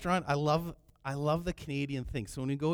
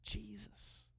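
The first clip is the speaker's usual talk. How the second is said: very hushed, higher little voice; timid little call; 5 semitones higher, 16 dB quieter than usual. Acted. Preacher shows how he didn't call, timid and hushed. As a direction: call his name so softly nobody would notice. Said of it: "No, he cried out".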